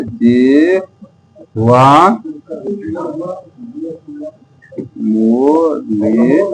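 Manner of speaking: very slow, drawn-out जीवा मूल्य; syllable by syllable. Shown as a demonstration. Teacher modelling the term for students, syllable by syllable. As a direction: stretch each syllable of जीवा मूल्य, pausing between. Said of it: "क्या बोलेंगे".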